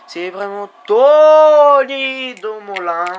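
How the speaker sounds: loud finish-line cry; excited shout. Real, not acted. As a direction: stretch the name out in a cheer.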